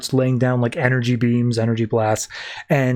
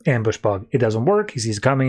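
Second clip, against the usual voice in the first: ordinary talk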